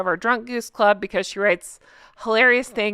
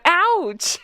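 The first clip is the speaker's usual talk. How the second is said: mock ouch; 9 semitones higher than usual. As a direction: wince theatrically at the burn. Repeats mock reaction, laughing after.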